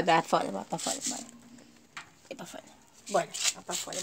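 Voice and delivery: very soft, low urging; barely voiced coaxing; hushed coaxing. Real, not acted. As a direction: quietly shoo the dogs out. Softly shooing dogs outside.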